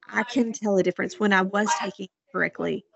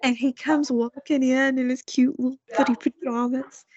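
ordinary talk, nothing marked

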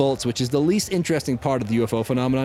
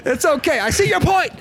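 high, lively protest; excited; 9 semitones higher than usual. Real, not acted. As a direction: pitch up, talk it out fast. Defending story, voice high.

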